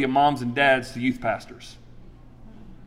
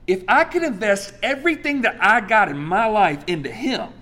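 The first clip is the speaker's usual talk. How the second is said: ordinary talk, nothing marked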